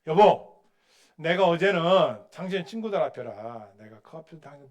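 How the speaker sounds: loud, firm wife confronting her husband; a wife calling her husband to account; mother scolding. Retold or imitated. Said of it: "이렇게 얘기를 하더래요".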